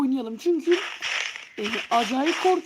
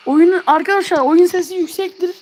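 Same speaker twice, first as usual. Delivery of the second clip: 1 loud warning; 3 semitones higher, 8 dB louder than usual. Real, not acted. Warning viewers about loud game.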